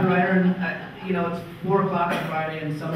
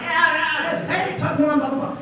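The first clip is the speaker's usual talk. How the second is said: much higher, gushing yeah yeah yeah; eager yes; 9 semitones higher, 4 dB louder, slower than usual. Retold or imitated. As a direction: jump at the invite. Eager reply to the PR woman.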